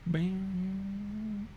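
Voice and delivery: distant, muffled mic sound; far away. Real, not acted. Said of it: "Why do you sound so far away?".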